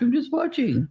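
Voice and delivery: high-pitched mock husband voice; imitating husband. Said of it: "He's like".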